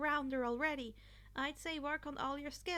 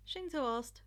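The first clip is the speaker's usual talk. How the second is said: plain reading voice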